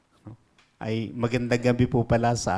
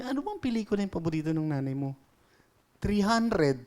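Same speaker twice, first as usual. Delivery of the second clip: put-on child voice; 7 semitones higher, faster than usual. Kid asks, nervous kid answers.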